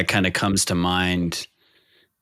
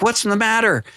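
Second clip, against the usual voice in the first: alarmed wife; 11 semitones higher than usual. Retold or imitated.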